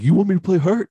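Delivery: put-on high, loud Boston-accented protest; indignant hockey player in a silly Boston accent. Hurt player challenges his coach, silly Boston accent. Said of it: "he's got a silly Boston accent".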